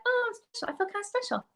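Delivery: high, soft, mock-flattered voice. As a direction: play it coy and pleased with yourself. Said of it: "half of me was like".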